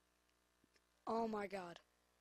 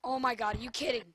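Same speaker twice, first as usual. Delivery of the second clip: usual speaking voice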